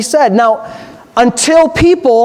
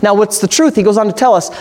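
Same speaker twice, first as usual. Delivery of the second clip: ordinary delivery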